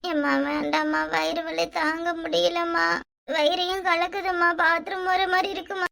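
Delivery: slow, weak plea to mother; listless, drawn-out sick voice; pained whining.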